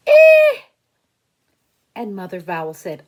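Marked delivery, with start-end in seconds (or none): 0.1-0.6 very high, very loud shriek; disgusted shriek. Acted. Worm crawls out of apple.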